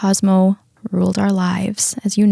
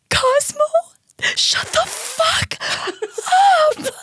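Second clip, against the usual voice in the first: very high, drawn-out hysterical mock scream; much slower than usual. Panicked driver to her barking dog, re-enacted screaming. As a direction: shriek the retold order at the dog, tearfully. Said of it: "screaming at him".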